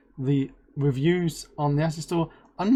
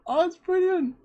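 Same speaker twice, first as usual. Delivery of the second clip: much higher, delighted exclaim; excited. Real, not acted. Host reacting to a giveaway win, high excited. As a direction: gush with real delight.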